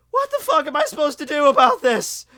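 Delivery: exasperated outburst. Real, not acted. Venting frustration at sob stories.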